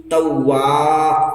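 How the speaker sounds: توا کو کھینچ کر; long vowel. Shown as a demonstration. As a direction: stretch توا slowly, holding the final ا. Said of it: "تھوڑا کھینچیں گے کیونکہ الف لگا ہوا ہے".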